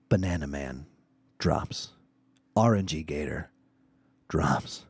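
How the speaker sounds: low, hushed dramatic game narration; grave, low recap of a last stand; suspenseful storytelling. Real, not acted. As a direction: build tension with quiet, spaced-out phrases. Suspenseful play-by-play of last fight.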